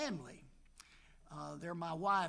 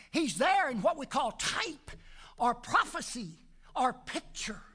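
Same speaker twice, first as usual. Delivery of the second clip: raised, ringing pulpit delivery; fired-up preaching; 5 semitones higher, 5 dB louder than usual. Acted. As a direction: preach it out to the congregation. Preaching resumes.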